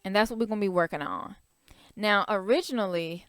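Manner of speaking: ordinary talk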